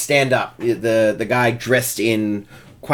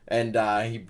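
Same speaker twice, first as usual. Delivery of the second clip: ordinary talk, nothing marked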